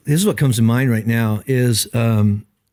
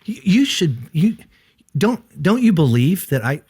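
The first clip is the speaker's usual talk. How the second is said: scolding; 4 semitones higher, slower than usual. Retold or imitated. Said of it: "He didn't say".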